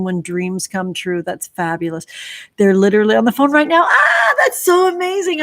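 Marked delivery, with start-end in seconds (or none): 2.6-5.4 real laughing shout, jumping much higher; excited. Real, not acted. Reacting to a viewer's dream job news, excited laughing shout. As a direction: burst out laughing with delight, fast and loud. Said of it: "I'm so excited".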